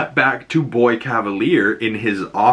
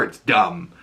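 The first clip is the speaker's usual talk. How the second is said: ordinary delivery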